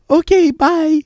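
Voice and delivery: very high singsong farewell, hammed up; exaggerated mock sign-off. Prankster gleefully hanging up on a telemarketer, exaggerated mock sign-off. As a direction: push the goodbye way up in pitch. Repeats the hang-up, more exaggerated.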